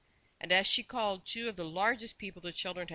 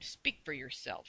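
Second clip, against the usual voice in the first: cross; 4 semitones lower, slower than usual. A narrator's reading. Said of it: "she said crossly".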